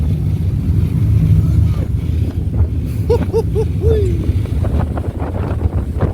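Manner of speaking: long airy laugh at the wind; breathy laughter. Real, not acted.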